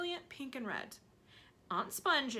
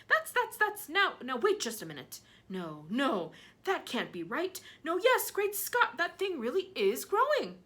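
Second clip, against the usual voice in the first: astonished; 8 semitones higher, 6 dB louder than usual. Acted. Aunt stammering in disbelief.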